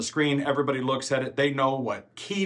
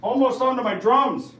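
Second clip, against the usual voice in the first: high, raised voice of real shock; startled outcry; 10 semitones higher, 4 dB louder than usual. Real, not acted. Vlogger reacting to gear nearly hitting his drums, shocked. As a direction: exclaim in alarmed disbelief.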